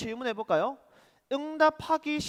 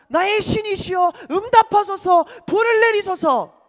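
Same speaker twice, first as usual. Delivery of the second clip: loud, high-pitched impassioned plea; desperate pleading cry; 8 semitones higher, 13 dB louder than usual. Acted. Worshippers calling on their god for fire, desperate shouted plea. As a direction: cry out desperately, full force. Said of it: "간절하게 외칩니다".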